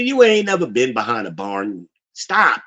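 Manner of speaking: ordinary delivery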